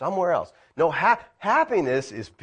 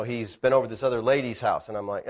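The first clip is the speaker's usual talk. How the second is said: normal talking voice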